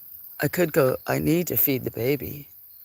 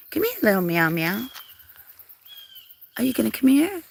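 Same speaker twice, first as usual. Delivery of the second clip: calling a cat; 9 semitones higher, 3 dB louder, faster than usual. Real, not acted. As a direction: coo and coax the cat over.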